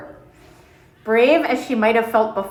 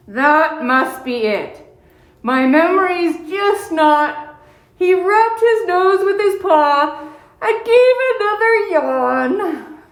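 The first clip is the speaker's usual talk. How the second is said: put-on sleepy old bear; 7 semitones higher, 3 dB louder, slower than usual.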